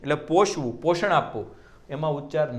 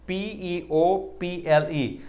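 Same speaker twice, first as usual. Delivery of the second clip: spelling out slowly; 3 semitones higher, much slower than usual. Shown as a demonstration. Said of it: "એવું નથી બોલતા".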